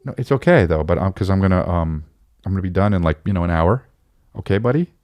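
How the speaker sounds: calm the little one before handing him off. Real, not acted. Dad comforting his son before sending him to mama. Father soothing baby.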